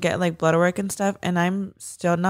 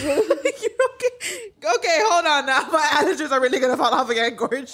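laughing; 5 semitones higher, faster than usual. Real, not acted. Laughing while comforting friend.

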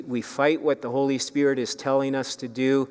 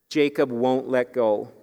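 ordinary delivery

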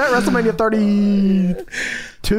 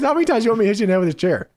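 fast quote; much faster than usual. Retold or imitated. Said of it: "He goes".

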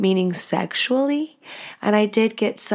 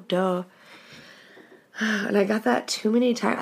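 usual speaking voice

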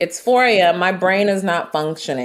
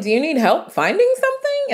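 mock helpful; 12 semitones higher than usual. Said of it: "I'm like".

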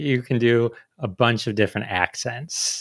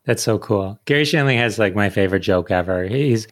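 ordinary talk, nothing marked

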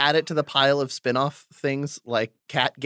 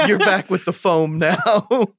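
laughing; 6 semitones higher, slower than usual. Real, not acted. Laughing remark.

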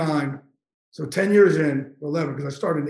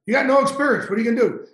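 higher-pitched mock challenge; dismissive boss; 6 semitones higher, 3 dB louder, faster than usual. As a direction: challenge a rookie, retold with push. Retelling boss's dismissive rejection.

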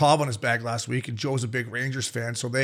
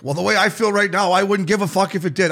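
hungover bravado; 6 semitones higher, 5 dB louder than usual. Retold or imitated. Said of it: "And I'm like".

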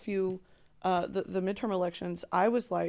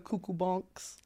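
Spencer; 2 semitones lower, 7 dB quieter, slower than usual. Retold or imitated. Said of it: "you know, Spencer says".

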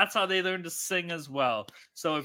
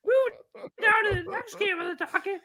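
squeaky announcer voice. Pretend. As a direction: squeal out the betting lines like a cartoon host. Drunk put-on announcer bit.

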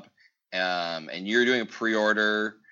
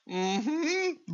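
tiny very high-pitched hum; sheepish confirmation; 13 semitones higher, 5 dB quieter than usual. Retold or imitated. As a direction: squeak out a reluctant yes. Husband sheepishly confirms the rising price.

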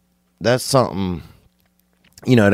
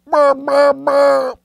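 very high imitated noise, repeated; short run of high comic burr sounds; high-pitched burrs; much slower than usual. Imitated noise after jambalaya squeal.

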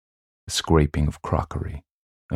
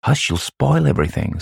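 put-on higher-pitched girl hushing someone; scolding girl; 10 semitones higher than usual. A narrator's reading. Girl stops child spoiling the game, scolding. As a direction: hush them like an annoyed little girl. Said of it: "some girl said".

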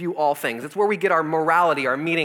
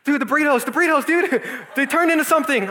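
much higher, louder frantic wail; panicked friend; 9 semitones higher, 3 dB louder than usual. Retold or imitated. Sick buddy panicking to his friend about burritos, high frantic.

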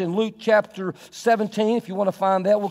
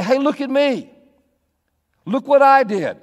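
boasting servant; 4 semitones higher, 4 dB louder than usual. Acted.

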